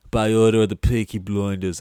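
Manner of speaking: Cillian Murphy impression. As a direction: deliver it like a menacing crime boss. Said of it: "Cillian Murphy being like".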